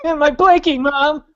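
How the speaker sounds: put-on high, fast whining kid begging his mom; mock a spoiled child crying for his blankie; whiny child. Friend mocks him as a whiny child calling for mom, high whine.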